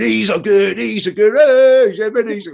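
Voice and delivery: loud, high mocking chant; exaggerated, high-pitched piss-take chant; taking the piss. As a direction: mess about noisily on the mic. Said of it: "like, taking the piss".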